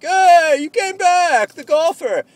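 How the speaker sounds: high, loud rowdy bar greeting; mock crowd cheer. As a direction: holler like bar buddies welcoming someone back. Bar crowd greeting him on return.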